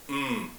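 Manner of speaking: "M" as a soft hummed sound; "M" as mmm, not the letter name; isolated consonant sound. Shown as a demonstration. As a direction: hum a short, quiet "M" on its own. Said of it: "is a consonant sound".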